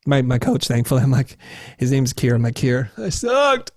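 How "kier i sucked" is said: high, drawn-out mock wail; acted-out slow, high lament; dejected confession. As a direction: whine to your coach about failing. Reports failure to his coach.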